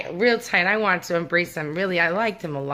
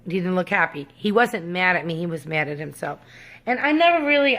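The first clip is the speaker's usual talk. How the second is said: ordinary delivery, nothing marked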